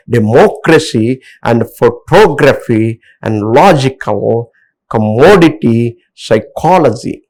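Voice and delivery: "democracy, photography, logical, commodity, psychology", each punched on the third-last syllable; stress on third-last syllable. Shown as a demonstration. Teacher reading examples to learners, each stressed on third-last syllable. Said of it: "last from third syllable".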